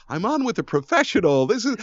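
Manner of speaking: high-pitched teasing imitation; nervous host quote. Guest teasing host about pre-show worry, high mock-fretful voice. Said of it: "Mark's like".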